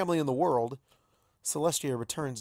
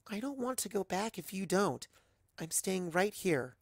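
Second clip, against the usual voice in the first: higher, softer, insistent; stubborn daughter; 7 semitones higher, 5 dB quieter than usual. Acted. Daughter hugging her parents, soft and stubborn. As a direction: dig in quietly.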